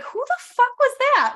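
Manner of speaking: high, loud, startled cry; shocked squeal. Retold or imitated. Speaker recalling her startled reaction to her inner voice, high shocked squeal. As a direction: squeal it out in disbelief.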